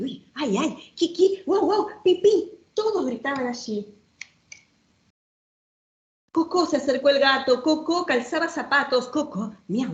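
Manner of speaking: playful, sing-song read-aloud with bouncing rhyme; reading to children. Acted. Showing a children's book to the audience, lively storytime reading.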